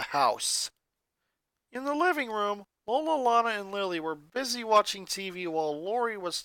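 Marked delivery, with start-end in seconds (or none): none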